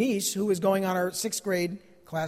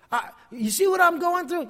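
urgent pleading question; 8 semitones higher than usual. Retold or imitated. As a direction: press them to look at you. Asking observers about his suffering.

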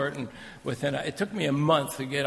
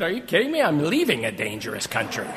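mock disbelief; 5 dB louder than usual. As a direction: toss back a joking retort with playful disbelief. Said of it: "I said".

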